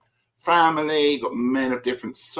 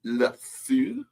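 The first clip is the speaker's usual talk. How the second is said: French accent. Shown as a demonstration. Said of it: "Is that my French accent?".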